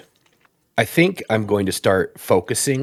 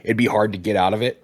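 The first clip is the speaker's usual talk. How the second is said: normal talking voice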